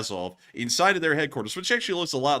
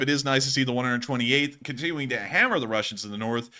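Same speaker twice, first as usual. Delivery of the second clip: ordinary delivery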